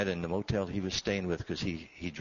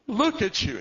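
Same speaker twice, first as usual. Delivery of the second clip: high, loud sobbing cry; tearful cry; 14 semitones higher, 9 dB louder than usual. Retold or imitated. Father embracing his lost son, crying out loud. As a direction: let it break out of him.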